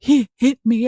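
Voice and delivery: high, loud gasped-out words, retold; breathless panic, acted out; gasping for breath. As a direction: re-enact struggling to get words out between gasps. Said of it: "trying to catch my breath".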